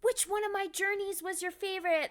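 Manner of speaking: lightly voiced Luffy asking a fan; Luffy actor. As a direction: ask it like a cheerful cartoon hero. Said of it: "And he was like".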